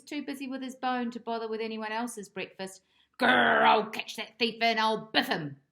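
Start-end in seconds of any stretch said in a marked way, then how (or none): 3.2-5.6 loud growling dog threat; a cross little dog growling loudly; growled threat. Acted. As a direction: growl it, then punch biff. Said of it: "growled Bob".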